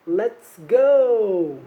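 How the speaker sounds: loud, drawn-out rallying call; rousing cheer. Pretend. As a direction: play a puppet cheering the class on. Voices puppet Cookie cheering class on.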